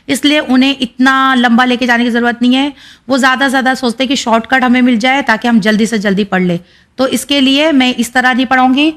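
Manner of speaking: ordinary talk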